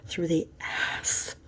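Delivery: soft unvoiced whisper of "Through the ass"; whispered repeat. Repeating the shocking detail to the listener in a whisper.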